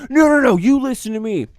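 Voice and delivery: loud, high-pitched cut-off; acted angry interruption. Irate customer cutting off bartender, angry. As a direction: shut him down, imitating an irate customer.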